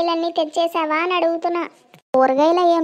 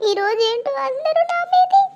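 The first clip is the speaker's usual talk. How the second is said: high whiny sob-talk; crying; 9 semitones higher than usual. Acted. Son whining to his mother, crying. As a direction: whimper it out like a hurt kid. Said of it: "అరే ఏడవకురా".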